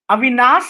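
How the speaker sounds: "Avinas" ending in a plain s instead of sh, said loudly; Bhojpuri pronunciation. Shown as a demonstration. Teacher showing how eastern UP says the name, Bhojpuri "Avinas". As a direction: punch out "Avinas" with a hissed final s. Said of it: "In Bhojpur, ... people who will call him".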